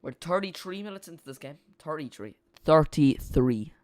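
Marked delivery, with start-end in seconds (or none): 2.7-3.7 slow, louder attempt at an Irish accent. Shown as a demonstration. Streamer tries a playful Irish accent for viewers, slow and louder. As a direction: drag it out in a hammed-up Irish accent. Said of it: "There'd be my Irish accent".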